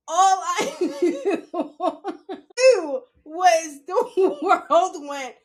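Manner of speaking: talking slowly through real laughter; can barely get it out for laughing. Real, not acted. Laughing recounting the fall.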